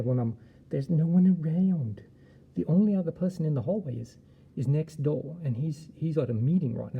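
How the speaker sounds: mock scared loud. Scared walking dark hallway.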